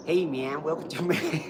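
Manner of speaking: put-on American, breaking into laughter; American accent. Shown as a demonstration. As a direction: greet like a cartoon American. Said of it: "do your best American accent".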